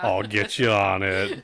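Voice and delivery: loud, drawn-out sarcasm; mock obliging agent. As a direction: pretend to give in, slowly and loudly. Acting out an agent's mock confession.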